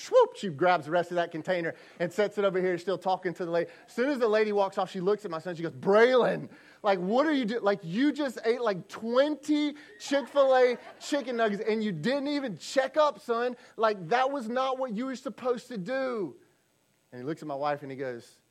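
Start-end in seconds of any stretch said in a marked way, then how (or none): 0.0-0.3 "swoop" jumps much higher and louder. Retold or imitated. Mom grabs nuggets fast. 5.7-16.3 higher, louder exasperated mom voice; scolding mom. Retold or imitated. Wife scolds son in story.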